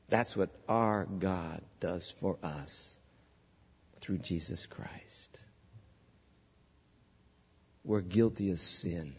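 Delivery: preacher turning to God's forgiveness, hushed; hushed and solemn. Real, not acted. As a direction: drop to a reverent near-whisper. Solemn turn to the gospel.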